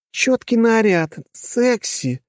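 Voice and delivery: high, slow delighted squeal. Acted. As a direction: gush over the outfit. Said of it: "порадовалась Кира".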